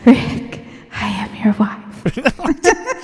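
slow, whispered bad villain imitation; drawn-out voiceless attempt at menace; Darth Vader. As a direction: half-whisper it like a clumsy villain. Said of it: "bad imitations of Darth Vader".

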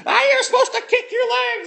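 high, loud scolding shout; screaming instructor. Retold or imitated. Angry swim instructor scolds the half-drowned kid, high and loud. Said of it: "she's screaming at me".

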